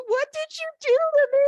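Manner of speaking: high, loud betrayed wail; outraged teen. Retold or imitated. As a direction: play the heartbroken daughter accusing her mom. Daughter's outraged cry retold.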